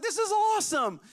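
high, excited retold cheer; gushing mock enthusiasm; pumped up. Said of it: "like, so pumped up about it".

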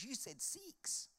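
high, slow, soft imitation Kiwi; giggly-girl New Zealand imitation; Kiwi accent. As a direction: mimic the teller's Kiwi accent, drawing out "six". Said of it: "this girl had a Kiwi accent".

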